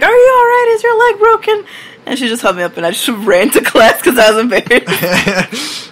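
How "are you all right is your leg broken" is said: high, worried lady's voice; a school bus lady asking a fallen kid if he's okay, high and worried; concerned lady. Retold or imitated. Lady checking on a fallen child, high concerned voice. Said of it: "and she was like".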